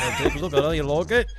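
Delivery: mock Yorkshire accent, a bit quick; hammed-up bad Yorkshire accent. Inviting friends to admire his motorbike, in a mock Yorkshire accent. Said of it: "This is Yorkshire accent".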